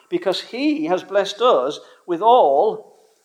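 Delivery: all drawn out and slowed; a slow weight on all; stress on all. Real, not acted. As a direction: lean hard on all, slowly. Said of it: "emphasis on the all".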